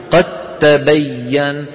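قد تبين with د turned into doubled ت; slow idgham demo. Shown as a demonstration. Teacher showing the merge. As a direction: say قد تبين slowly as قتّبين. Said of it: "شايفين؟".

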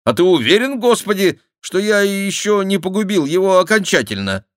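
high, loud questioning; put-on high voice arguing back; objecting mock penitent. A narrator's reading. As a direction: challenge the voice loudly, pitched high. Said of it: "возразил Шико".

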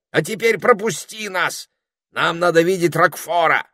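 high firm demand; commanding parrot. A narrator's reading. Parrot demanding to see Roquefort. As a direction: order him aside.

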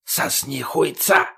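hissing dead man's rasped taunt; hissed. A narrator's reading. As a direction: hiss it out, half voiceless at first. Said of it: "и прошипел".

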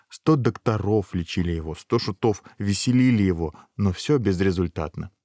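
ordinary delivery

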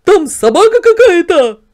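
high, very loud character cry; astonished shout. A narrator's reading. Yard dog spots a dog in mirror, shouting. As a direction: shout the startling discovery. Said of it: "закричал он, показывая на зеркало".

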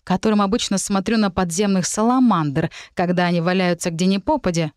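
ordinary delivery, nothing marked

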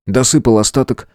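usual speaking voice

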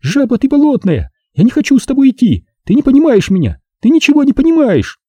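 put-on very high girlish outburst; hysterical girl. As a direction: fling insults, voice high up. Zaza struggling, screaming at him.

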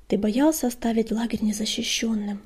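plain reading voice

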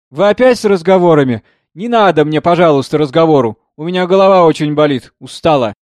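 loud, weary complaint; irritated brush-off. A narrator's reading. Weary singer cuts off his talk. (раздражённо, устало отмахиваясь)

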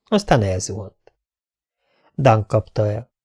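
usual speaking voice

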